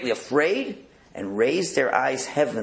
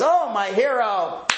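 higher, slow mock swoon; swooning princess; 9 semitones higher, 4 dB louder, slower than usual. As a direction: gush at the hero. Princess swooning at her rescuer.

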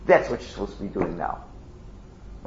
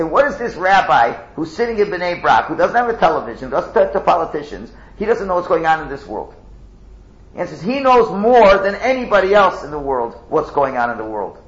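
fervent preaching; 5 semitones higher, 6 dB louder than usual. Real, not acted. Loud preaching with skeptic quote.

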